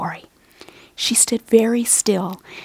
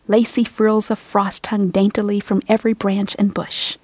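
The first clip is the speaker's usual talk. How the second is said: plain reading voice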